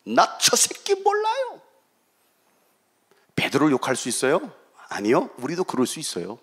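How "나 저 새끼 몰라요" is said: high, drawn-out mock cursing; cursing denial. Peter disowning Jesus, high cursing denial. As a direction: imitate a vehement, stretched-out denial at high pitch. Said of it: "저주하면서".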